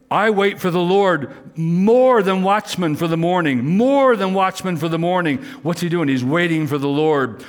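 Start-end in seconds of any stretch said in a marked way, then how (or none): 0.1-5.4 high, slow, swelling preacher's reading; fervent scripture reading. Acted. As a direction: pour the psalm out like a plea. Preaching the psalm text.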